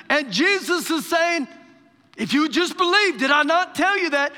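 high, loud, driving exhortation; full-force preaching. Real, not acted. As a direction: urge the congregation insistently.

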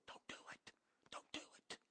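barely audible whisper; imitated still, small voice, all breath; whispered warning. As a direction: whisper it like an inner voice warning you. Retelling God's inner warning.

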